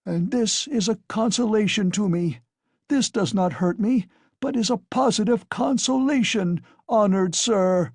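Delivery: put-on a drunk cheerfully suffering his punishment; called out. A narrator's reading. Husband dragged by his hair, meek call to guest. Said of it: "he called out".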